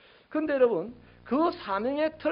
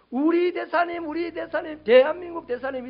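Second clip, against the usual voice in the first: high, raised mock cheering; admiring acclaim; 7 semitones higher, 6 dB louder than usual. Citizens abroad hailing their ambassador, admiringly. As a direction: call out the title with pride. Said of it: "이렇게 할 거예요".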